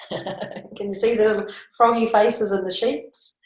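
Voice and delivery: usual speaking voice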